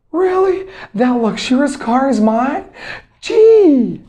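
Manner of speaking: high-pitched amazed delight, building to a loud gee; acted enthusiasm. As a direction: squeal it like you just won a car. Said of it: "when you express your enthusiasm".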